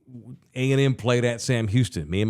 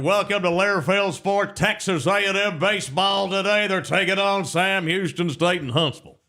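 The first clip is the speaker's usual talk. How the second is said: booming pregame welcome; Dave South announcer voice; 8 semitones higher than usual. Retold or imitated. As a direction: belt it like an old-time ballgame broadcaster. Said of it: "this guy's screaming".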